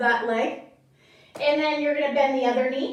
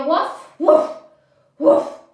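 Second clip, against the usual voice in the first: dog bark; 4 semitones higher, 8 dB louder than usual. Shown as a demonstration. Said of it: "Can I hear you".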